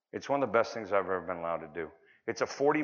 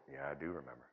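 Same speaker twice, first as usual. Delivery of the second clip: much lower and softer, hushed; soft reply; 9 semitones lower, 15 dB quieter than usual. Retold or imitated. Father answering his son, hushed and soft.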